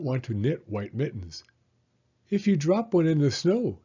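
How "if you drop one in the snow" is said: warning grandmother. Acted. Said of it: "she warned".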